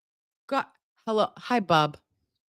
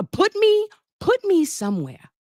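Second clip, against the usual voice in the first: overexcited; 14 semitones higher, 3 dB louder than usual. Real, not acted. Overwhelmed by the new motions.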